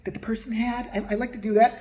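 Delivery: lightly mimicked medium, pitched high; high, softened mock-medium voice; Tyler Henry. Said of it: "the first thing he goes".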